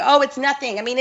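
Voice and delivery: ordinary talk, nothing marked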